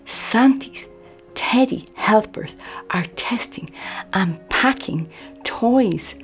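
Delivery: ordinary delivery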